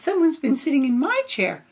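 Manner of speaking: high, loud mama bear; mother bear voice. Acted. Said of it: "And she said".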